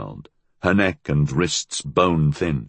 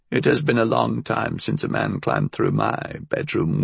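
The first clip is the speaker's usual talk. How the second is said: put-on old crone voice; 6 semitones higher than usual. Old woman mocks.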